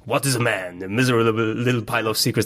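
theatrical, overblown villain quote; hammy stage actor. Retold or imitated. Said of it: "dieses theatralische, überkandidelte".

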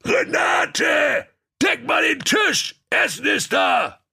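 booming ogre calling his wife; frightening ogre voice. Acted. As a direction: bellow like a hungry monster. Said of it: "eine furchterregende Stimme rief".

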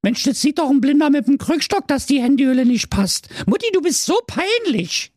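put-on high fast embarrassed teen; high exasperated teen; annoyed teenager. A narrator's reading.

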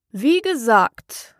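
very slow, drawn-out resumption; sleepy Dormouse. A narrator's reading. Dormouse resuming its story, slow and sleepy. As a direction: drag the words out drowsily.

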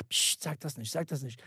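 low, very soft warning; imitated hushing. Imitating Germans wary of a taboo subject, hushed and low. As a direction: shush them as if the word were forbidden. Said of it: "die sind alle so".

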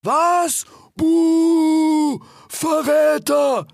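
high, drawn-out booing; crowd calling out. A narrator's reading. Animals boo Rix for refusing, jeering high. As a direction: jeer high, dragging out the words. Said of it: "riefen die Tiere".

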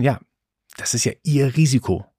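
ordinary delivery

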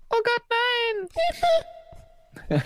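high shocked cry; mock horror. Real, not acted. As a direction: gasp it in horror. Reacting to the Easter bunny.

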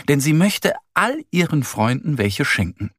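ordinary delivery, nothing marked

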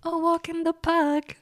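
"A walk in the park" lifted high and drawn out like a jingle; playful high singsong echo; singsong repeat. Shown as a demonstration. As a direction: croon it up high as a theme tune. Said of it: "wenn du die Musik hier einspielen würdest".

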